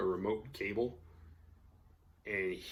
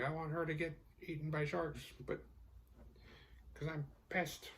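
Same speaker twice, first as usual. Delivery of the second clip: bitter ex; 7 semitones higher, 9 dB quieter than usual. Retold or imitated. Voicing bitter Dennis.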